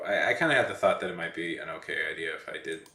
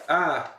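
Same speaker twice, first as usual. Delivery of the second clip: sudden loud ah to startle; jump-scare yell; startling shout; 8 semitones higher, 6 dB louder than usual. Pretend. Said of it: "you're pretty easily startled".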